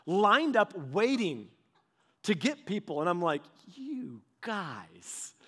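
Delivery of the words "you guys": incredulous murmur. Retold or imitated. Disbelief at the squad cars.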